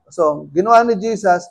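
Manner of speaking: normal talking voice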